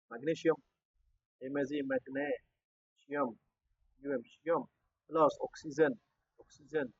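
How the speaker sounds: very soft, drawn out as if writing along; murmured spelling-out. Real, not acted. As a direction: murmur it slowly to yourself, word by word. Spelling out while writing the equation.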